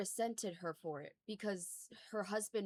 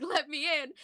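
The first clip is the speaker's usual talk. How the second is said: mock plea; 7 semitones higher, 7 dB louder than usual.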